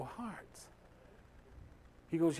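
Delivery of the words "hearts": "Hearts." said much softer, as a quiet question rising at the end; puzzled echo. Retold or imitated. Pastor echoing the boy's odd answer in soft surprise. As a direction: echo "Hearts." back softly, wondering, pitch rising. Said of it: "I go".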